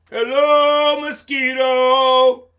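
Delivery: loud, high, slow booming greeting; big elephant voice. Acted. Elephant greeting tiny mosquito, big and drawn out. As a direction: call out hugely as a storybook elephant.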